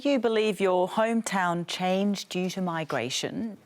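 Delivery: ordinary talk, nothing marked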